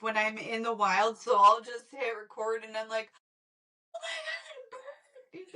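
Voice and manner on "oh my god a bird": high squealing excitement; excited squeal. Retold or imitated. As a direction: squeal at a bird. Excited bird sighting retold.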